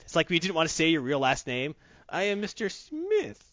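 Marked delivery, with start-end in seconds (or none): 2.1-3.4 high, slow put-on French accent; mock French noble. Player mimics his noble introducing himself, mock French accent. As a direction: announce yourself grandly as a French noble. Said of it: "is like saying Smith".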